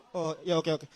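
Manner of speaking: usual speaking voice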